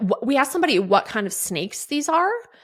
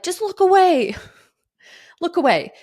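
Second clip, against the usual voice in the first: put-on pleading little boy; 9 semitones higher than usual. Son's plea retold.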